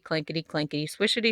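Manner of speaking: usual speaking voice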